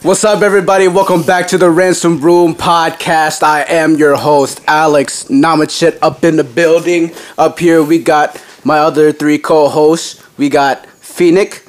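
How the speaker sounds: loud, upbeat podcast host opener; podcast host welcoming listeners; host intro. Acted. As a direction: hype up the show like a host.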